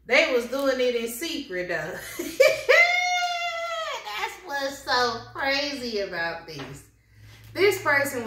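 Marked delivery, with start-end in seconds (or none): 1.8-4.1 a shrieking real laugh; loud high laugh. Real, not acted.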